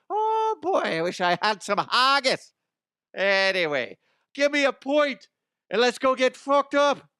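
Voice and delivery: high, loud stage-Irish brogue; outrageous mock Irish leprechaun; Irish accent. Shown as a demonstration. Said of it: "the most insulting one is the Irish accent that I do".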